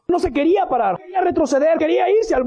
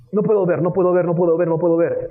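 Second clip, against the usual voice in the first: lower, repeated refusal; mock self-restraint; 4 semitones lower than usual. Preacher playing tempted David, mock self-restraint. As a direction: mimic someone talking himself out of looking.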